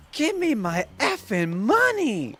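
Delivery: high, loud imitated screech of a furious girl; mock yell. Retelling a Vine girl demanding her money, mock screech. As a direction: shriek it like the Vine girl throwing the doll. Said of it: "she's like".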